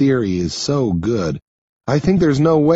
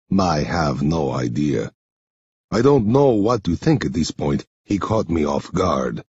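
low, subdued man's voice; understated, lower-pitched character voice; Henri character voice; 7 semitones lower than usual. Acted. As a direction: admit it in Henri's lower register.

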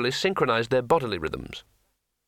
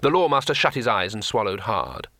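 ordinary delivery

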